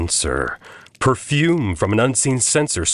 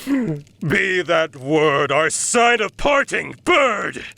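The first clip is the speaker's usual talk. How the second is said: high, shouted shriek; 9 semitones higher, slower than usual. Acted. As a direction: scream it at the bird. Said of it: "I shrieked".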